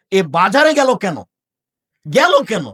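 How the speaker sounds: preacher scolding the absent daughter, loud and indignant; outraged question. Acted. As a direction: hurl the question at the crowd.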